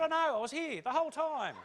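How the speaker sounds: high, loud protest; exasperated retort. Acted. Speaker answering men back from their discussion, exasperated retort. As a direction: throw it back loudly at something obvious.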